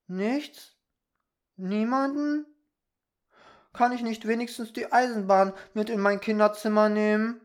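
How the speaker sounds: put-on high, loud, incredulous boy's questions; dismayed pleading child. Peter begs fairy to keep the railway, pleading. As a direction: plead like a let-down little boy.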